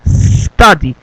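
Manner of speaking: "study" starting on a drawn-out S; initial S, no vowel. Shown as a demonstration. Teacher showing Spanish speakers how, "study" straight from S. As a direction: stretch the S that opens "study", no vowel before it. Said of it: "se pronuncia a partir de la S".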